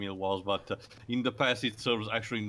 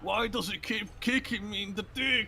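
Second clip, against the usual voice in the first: whiny victim voice; 11 semitones higher than usual. Retold or imitated. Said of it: "it's like in Dragon Ball Z".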